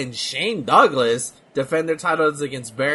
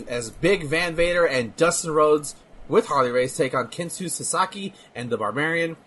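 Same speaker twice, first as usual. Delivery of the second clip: ordinary talk, nothing marked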